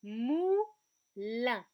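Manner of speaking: "moulin" loud, split as mou, lin; two long separate beats on "moulin"; syllable by syllable. Shown as a demonstration. Teacher presents a new word, loudly split into syllables. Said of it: "il s'agit du mot".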